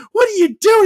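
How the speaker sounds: a hammed-up shrieking girlfriend; girlfriend's voice. Girlfriend bursting in on him, in a high loud mock voice.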